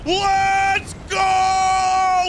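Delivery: loud triumphant yell; victory shout. Real, not acted. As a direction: cheer the win at full voice. Victory after a tense fight.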